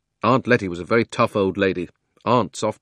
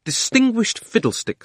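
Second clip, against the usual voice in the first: high-pitched scornful retort; scornful aunt; 13 semitones higher, 3 dB louder than usual. Acted. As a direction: throw the word back high and scornful. Aunt scornfully echoes his claim.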